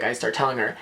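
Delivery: ordinary talk, nothing marked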